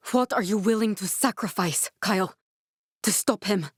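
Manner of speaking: ordinary delivery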